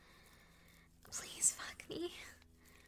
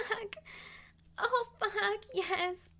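ordinary talk

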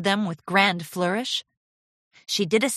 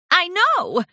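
put-on bright, eager young pony voice; lightly voiced eager filly; 12 semitones higher, 6 dB louder than usual. A narrator's reading. Charity voiced in story.

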